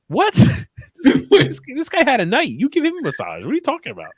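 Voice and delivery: high, squeaky disbelief; mock outrage at the absurd request; incredulous. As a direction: voice jumping way up in disbelief. Incredulous rant at movie scene.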